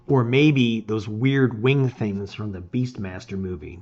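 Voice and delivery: plain reading voice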